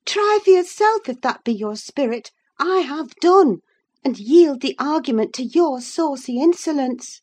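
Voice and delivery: high, forceful dismissal; heated cry. Acted. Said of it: "cried the other".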